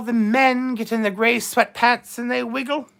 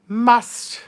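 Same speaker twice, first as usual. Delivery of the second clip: ordinary talk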